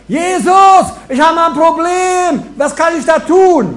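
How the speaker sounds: high, loud, slow frantic cry to Jesus; overdone anxious pleading; mock panicked plea. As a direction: ham up a desperate prayer.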